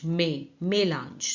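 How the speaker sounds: मे, मेलांज drawn out slowly, first syllable alone; slow pronunciation. Shown as a demonstration. Teacher spelling out the sound slowly for students. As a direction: break मेलांज apart, then say it whole. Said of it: "इसको कहेंगे मेलांज".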